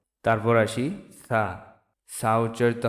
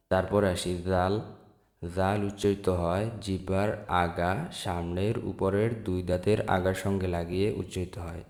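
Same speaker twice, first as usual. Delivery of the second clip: ordinary talk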